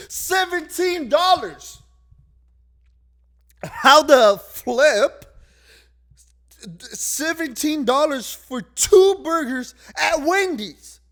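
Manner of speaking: high, loud, slow outraged disbelief. Real, not acted. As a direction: stretch each word out in disgust at the price. Outraged at burger price.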